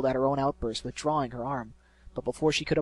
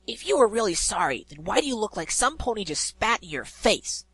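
higher, sharper challenge; scowling retort; 7 semitones higher than usual. Acted. Pegasus scowling back at the griffin, sharp retort. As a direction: throw the scowl right back. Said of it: "wearing her own scowl now".

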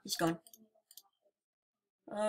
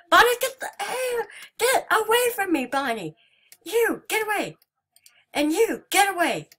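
high, loud frantic shouts; panicked yelling; 11 semitones higher, 6 dB louder than usual. Real, not acted. Terrified player yelling at the animatronics to go away. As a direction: scream them away from you.

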